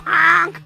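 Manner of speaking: loud high honk; goose honk. Acted. Honking at Will jokingly.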